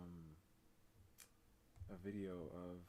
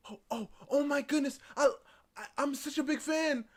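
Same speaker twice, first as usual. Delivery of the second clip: starstruck fan; 13 semitones higher, 11 dB louder than usual. Retold or imitated. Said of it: "somebody walk up to her".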